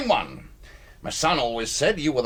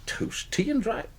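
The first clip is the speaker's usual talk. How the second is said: shocked disbelief; 8 semitones higher, 4 dB quieter than usual. Acted. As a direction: repeat it as if you can't believe it. Shocked by the poverty news.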